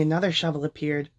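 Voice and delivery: plain reading voice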